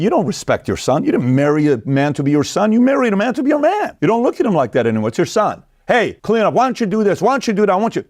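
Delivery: high, shrill-pitched nagging wife voice; mocking nagging wife. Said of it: "you look at your husband and say".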